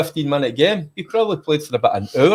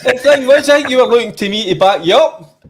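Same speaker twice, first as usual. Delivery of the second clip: laughing; 8 semitones higher, 5 dB louder than usual. Real, not acted. Laughing reply to the teasing.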